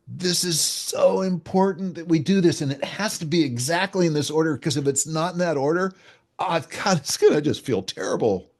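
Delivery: urgent, heartfelt plea; emotional. Shown as a demonstration. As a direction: gush it like it really matters to you. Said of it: "that's more emotion than I normally put".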